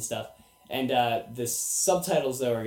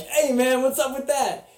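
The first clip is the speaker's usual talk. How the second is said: much higher and louder, indignant street guys; mock hoodlums; 13 semitones higher, 7 dB louder than usual. Hoodlums to Jason after he slashes their boombox, mock outrage. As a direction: yelp it like offended thugs. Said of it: "and they're like".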